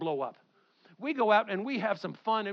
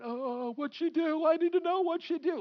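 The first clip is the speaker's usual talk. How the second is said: Martha; 10 semitones higher, 4 dB louder than usual. Acted. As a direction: play a fussy busybody wailing her questions. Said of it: "And Martha's going".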